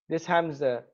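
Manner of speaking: ordinary talk, nothing marked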